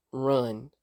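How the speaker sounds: low, creepy one-word command; creepy warning. Retold or imitated. Recapper voicing Tyler giving her a head start, creepy and low. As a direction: drop low and make it chilling. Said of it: "that scene was so creepy".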